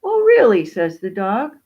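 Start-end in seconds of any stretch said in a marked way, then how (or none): 0.0-0.6 high, loud surprised question; exclaimed, high-pitched astonishment; surprised dog. Acted. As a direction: shoot the pitch up in disbelief. Dog reacts to peacock's boast.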